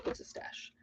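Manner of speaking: unvoiced whisper to herself; whispered aside. Real, not acted. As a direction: breathe it as a secret. Playful whispered repeat.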